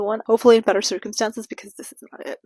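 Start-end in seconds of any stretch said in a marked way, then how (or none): 1.5-2.4 whispered aside. Real, not acted. Signing off the rant.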